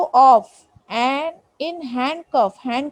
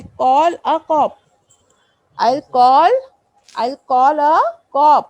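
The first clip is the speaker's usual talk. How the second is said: louder threatening call a cop; mock threat; 5 dB louder, much slower than usual. Poet threatening the reader with the police, louder.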